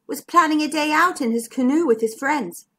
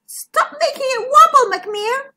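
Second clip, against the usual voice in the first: high, loud bird squawk; 14 semitones higher, 5 dB louder than usual. A narrator's reading. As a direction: squawk in panic. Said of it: "squawked Bertie".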